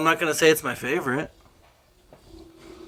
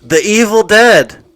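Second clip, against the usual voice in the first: louder, slow, raised voice; speaking up; 5 semitones higher, 8 dB louder, slower than usual. Real, not acted. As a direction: say it louder for them. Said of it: "Speak up".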